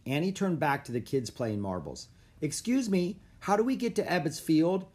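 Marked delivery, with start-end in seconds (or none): 2.4-4.9 high-pitched, polite call; raised-pitch question sent across a park. A narrator's reading. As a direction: call a question over to strangers. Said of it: "she called".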